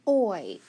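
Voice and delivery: "oi" said loud as one gliding sound; isolated oi sound. Shown as a demonstration. Teacher asks pupils to spell the sound, isolated oi. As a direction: sound out "oi" by itself. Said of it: "So if I say".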